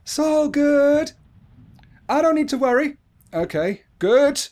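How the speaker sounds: high, loud self-soothing; high-pitched forced reassurance; nervous self-reassurance. Real, not acted. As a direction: talk yourself out of fear in a high voice. Reassuring self in scary game.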